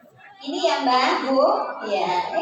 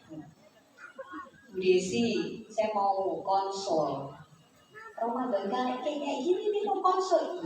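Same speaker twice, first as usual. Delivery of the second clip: quiet client; 3 semitones lower, 8 dB quieter, much slower than usual. Retold or imitated. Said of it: "patient asking to consult".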